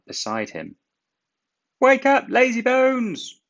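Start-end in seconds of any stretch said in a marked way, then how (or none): 1.8-3.4 very high, loud piping engine voice; high shrill whistle of a call; whistling cheeky call. A narrator's reading. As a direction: toot it out like a cheeky little engine. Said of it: "whistled Thomas".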